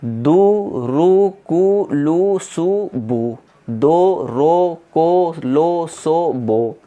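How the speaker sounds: दू, रू, कू chanted with long held vowels; drawn-out syllables. Shown as a demonstration. Teacher repeating the drill for students, each syllable drawn out. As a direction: stretch every vowel from दू to बो. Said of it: "ताकत लगा के खींच के पढ़ना है".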